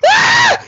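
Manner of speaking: high-pitched shriek of terror; short, piercing, loud yelp; girlish scream. Retold or imitated. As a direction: scream like a girl. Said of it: "I scream like a girl".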